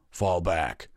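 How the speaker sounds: loud, brief battle command; called out. A narrator's reading. Vanguard orders his troops to retreat, calling out. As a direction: call the retreat. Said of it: "he called".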